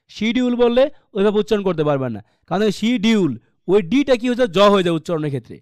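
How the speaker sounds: ordinary talk, nothing marked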